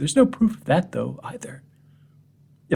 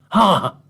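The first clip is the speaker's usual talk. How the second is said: scornful ha; 7 semitones higher, 4 dB louder than usual. Acted. Scornful bark of laughter.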